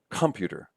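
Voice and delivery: computer as COM-pyu-ter; stress on first syllable. Shown as a demonstration. Lecturer shows wrong stress, computer said COM-pyu-ter. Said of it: "We don't say computer".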